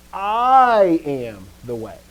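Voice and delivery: slow, with "I" stretched long and loud; weighty stress on "I"; emphatic I. Retold or imitated. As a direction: draw out "I" hard, then let the rest fall. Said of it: "he's actually emphasizing his claim".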